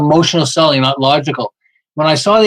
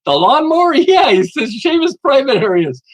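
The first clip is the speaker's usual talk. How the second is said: put-on gossiping girlfriend; 7 semitones higher than usual. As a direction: play the giggling girlfriend spreading the news. Mary called his girlfriend.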